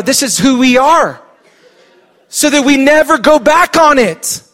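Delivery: high, loud pulpit push; rally the church hard; full-force preaching. Acted.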